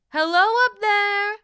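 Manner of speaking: loud, high mother's call. A narrator's reading. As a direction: call to someone high above you. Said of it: "the boy's mother called".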